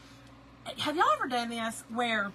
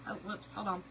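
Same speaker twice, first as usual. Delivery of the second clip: ordinary delivery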